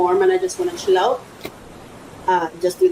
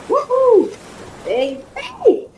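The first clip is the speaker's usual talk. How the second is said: excited whoop; 13 semitones higher, much slower than usual. Real, not acted. Excited over the new tub.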